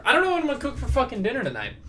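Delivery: fast, high, frustrated burst; exasperated rant. Real, not acted. Podcaster venting about tonight's dinner, fast exasperated burst.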